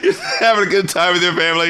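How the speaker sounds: ordinary talk, nothing marked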